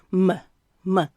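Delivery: "m" as an isolated muh, said twice; two separate "m" syllables, each with a neutral uh; schwa sound. Shown as a demonstration. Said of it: "So that's the schwa sound".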